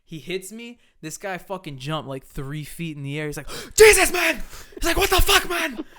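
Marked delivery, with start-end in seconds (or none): 3.6-4.4 high, loud yelp of shock; startled yell. Retold or imitated. Startled worker jumps in the air. 5.0-5.9 high, loud outraged shock; hammed-up imitation of a startled worker; shocked yell. Startled worker after being bumped.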